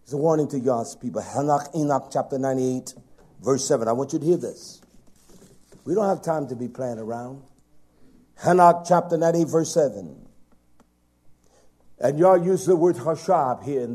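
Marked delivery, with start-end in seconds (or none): none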